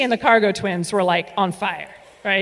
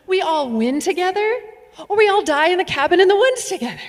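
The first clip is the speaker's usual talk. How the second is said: high lively lecture; 7 semitones higher than usual. Retold or imitated. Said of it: "And I said".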